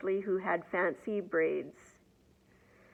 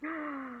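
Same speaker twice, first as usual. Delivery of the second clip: sharp soft gasp of amazement; a quick astonished intake of breath; 6 semitones higher, 11 dB quieter than usual. Acted. Reader reacts to the braiding.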